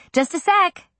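high call through a door. A narrator's reading. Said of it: "I called out".

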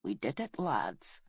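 soft, low, weakened voice; weak dying voice. A narrator's reading. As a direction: speak low with failing strength. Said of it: "She coughed weakly and swallowed".

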